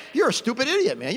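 ordinary talk

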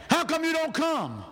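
high-pitched indignant demand, imitated; mock angry relatives. Preacher imitating his family upset at missed gatherings, mock anger. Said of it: "they used to get mad".

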